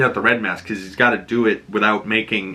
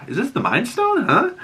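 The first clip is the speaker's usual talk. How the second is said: high, puzzled wondering; 12 semitones higher than usual. Retold or imitated. Fan puzzling over the yellow stone, high. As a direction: ask it high, half amazed. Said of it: "I was sitting there going".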